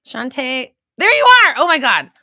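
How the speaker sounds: a real high, loud shout of greeting; excited scream. Real, not acted. Host greeting a friend arriving late, screaming with excitement. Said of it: "I didn't mean to scream at you guys".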